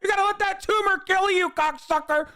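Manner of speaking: high, heated ranting at the screen; angry rant. Real, not acted. Streamer ranting at a video he hates, heated. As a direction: lay into him, fired up.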